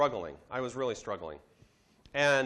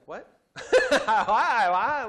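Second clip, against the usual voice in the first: higher and louder, laughing out a disbelieving "Why?"; baffled laughing protest; 9 semitones higher, 9 dB louder, much slower than usual. Retold or imitated. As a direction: laugh in disbelief at being thrown out. Baffled reaction to being sent out.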